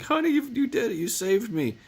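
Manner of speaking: high falsetto as the wife; mock high wife voice, relieved; wife's voice. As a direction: squeak it up like his grateful wife. Wife voiced in retold scene.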